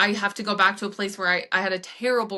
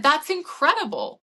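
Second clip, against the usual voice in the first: bright, raised burst of praise for the client; excited praise; 7 semitones higher, 3 dB louder than usual. Retold or imitated. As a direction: cheer her on with a real lift in pitch.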